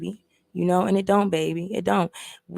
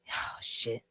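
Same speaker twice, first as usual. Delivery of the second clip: hushed oh shit; 8 semitones lower, 13 dB quieter than usual. Retold or imitated. Reacts to being told to tame bird.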